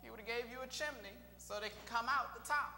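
normal talking voice

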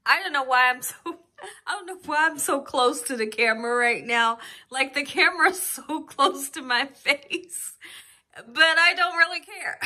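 giggling through the words; breathless giggly chatter; laughing. Real, not acted. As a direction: laugh while you talk about the camera. Laughing at camera closeness.

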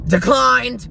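loud high mock cashier yell; mock shout. Imitating the cashier announcing her card declined, loud. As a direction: bellow it like the clerk did. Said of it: "he was literally screaming it".

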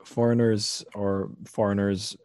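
normal talking voice

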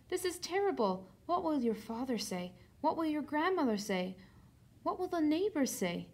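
lightly hammed-up mum, lamenting in a list; fretting mother. A narrator's reading. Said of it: "You know how mothers do that".

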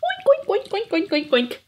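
high squealing oinks, dropping down; pig squeals. Acted. Piglet crying home to children, high squealing oinks. Little pig cries all the way.

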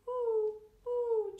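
high, loud laughing hoots; chuckling owl hoots. A narrator's reading. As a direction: hoot with amusement like an owl. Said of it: "chuckles Mister Owl".

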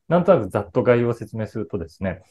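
ordinary talk

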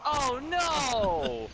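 high loud oh no; dismayed cry. Real, not acted. Mistake in game.

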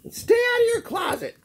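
very high, fast mother voice; parting order. Acted. Mama cat adding an order while closing the door. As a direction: toss it back over the shoulder. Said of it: "she added".

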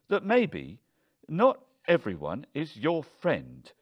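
high, loud, cutting challenge; heated, emphatic rebuke; pointed anger. A narrator's reading. Husband rounds on wife.

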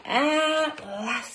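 high, drawn-out storybook relief; relieved penguin. Acted. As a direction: let it out high and long.

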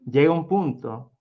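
ordinary delivery, nothing marked

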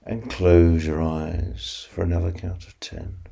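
slow counting hypnosis voice; guided sleep meditation. Acted. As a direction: ease them toward sleep, unhurried.

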